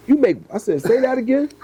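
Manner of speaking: high, pressed "say that again"; disbelief. Retold or imitated. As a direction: act floored by the number. Stunned by the breeder's income.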